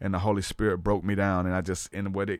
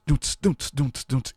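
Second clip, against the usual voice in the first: mouthed rhythmic drum thumps; beatbox a simple repeating drum groove; imitated drum beat; 3 semitones higher, slower than usual. Telling how the church band got playing, imitated drums. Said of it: "playing those drums".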